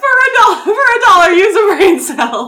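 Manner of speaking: very high, loud cartoon squeak; squeaky mock voice. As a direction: mimic it in a shrill toy voice. Repeating line in silly voice.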